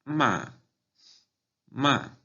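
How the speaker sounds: "ma" high and sharply falling; fourth tone. Shown as a demonstration. Teacher giving the word for scold, "ma" falling from high. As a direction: drop "ma" steeply from the top. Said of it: "esta palabra en cuarto tono".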